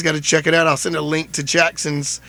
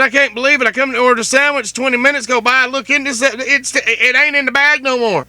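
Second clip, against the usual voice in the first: mock outrage; 7 semitones higher than usual. Joking rant to the worker about the missing sandwich, hammed up. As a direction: rant like a wronged customer, all in jest. Keeps up fake complaint.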